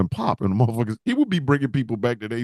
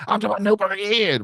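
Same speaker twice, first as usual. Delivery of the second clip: high, hammed-up commentator imitation; mock the announcer's overselling; pitched-up hype with a stretched last word; Booker T; 7 semitones higher than usual. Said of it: "You talking about".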